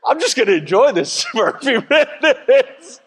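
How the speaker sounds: giggling, pleased with himself; laughing through. Retold or imitated. Husband delighting in the silence, laughing. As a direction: laugh as you say it.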